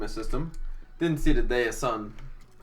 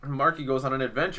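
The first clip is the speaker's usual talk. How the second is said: ordinary talk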